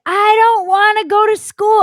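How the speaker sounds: put-on high, loud whiny kid wail; childish tantrum. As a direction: whine it like a kid refusing school. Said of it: "you actually said those words?".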